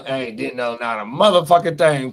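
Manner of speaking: ordinary delivery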